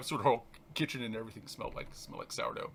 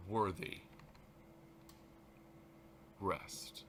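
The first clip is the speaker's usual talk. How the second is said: soft spiritual text read aloud; meditative reading; 4 semitones lower, 4 dB quieter than usual. Acted. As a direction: let each line settle like a breath. Reads text slowly, meditative.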